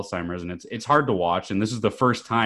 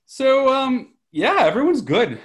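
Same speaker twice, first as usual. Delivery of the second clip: excited; 7 dB louder, slower than usual. Real, not acted. Excited reply.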